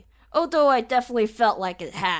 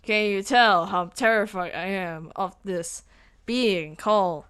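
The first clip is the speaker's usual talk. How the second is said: ordinary talk, nothing marked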